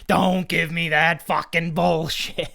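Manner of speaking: loud, high, drawn-out mock outburst; exaggerated slow defensive protest. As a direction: stretch it out, hammed-up indignation.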